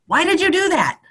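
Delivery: tender, high questioning voice; gentle question. Retold or imitated. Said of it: "put the right words in my mouth".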